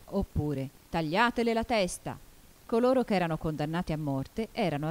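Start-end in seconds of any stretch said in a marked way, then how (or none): 0.9-2.2 a storyteller's restrained royal yell; mild shouted order. A narrator's reading. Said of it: "gridando sempre". (ordine gridato con moderazione)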